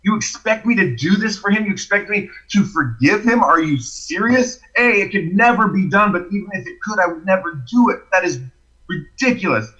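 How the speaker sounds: retold angry rant; indignant disbelief. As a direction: protest as if outraged by the very request. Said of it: "it made me angry on the inside".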